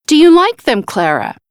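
proud question, lifted high and loud; eager, proud father's question. A narrator's reading. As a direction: ask it proudly, falling on the name. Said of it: "he asked proudly".

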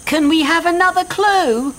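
put-on high boy's voice calling up; high-pitched shouted question; shouting. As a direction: call out a request to someone above. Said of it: "Ben looked up and shouted".

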